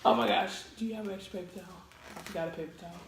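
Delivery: ordinary delivery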